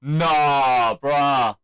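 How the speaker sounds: loud, drawn-out fan reaction; re-enacted loud amazement; retold disbelief. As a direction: exclaim it in stunned disbelief. Retold fan reaction to surprise entrance.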